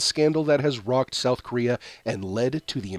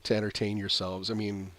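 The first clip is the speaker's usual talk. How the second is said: usual speaking voice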